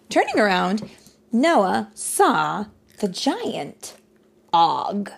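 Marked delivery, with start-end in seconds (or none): none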